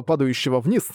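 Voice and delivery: ordinary delivery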